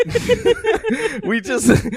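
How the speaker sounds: high, stretched laugh through words; cracking up mid-phrase. Real, not acted. Laughing at own joke.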